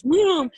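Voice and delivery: loud high whooshing vroom; vroom noise. Retold or imitated. To her listeners, imitating the ride plunging, loud high vroom. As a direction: shoot the sound off like the drop. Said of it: "It goes".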